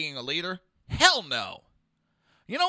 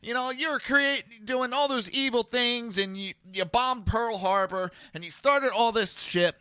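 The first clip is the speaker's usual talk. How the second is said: high-pitched mock pleading, easing down toward usual; mock apologizing; 6 semitones higher than usual. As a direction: imitate a grovelling leader making excuses. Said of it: "Trump running to Japan and going".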